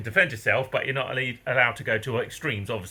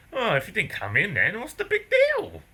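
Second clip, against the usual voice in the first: mocking staff voice; 8 semitones higher than usual. Mocking office staff's reply.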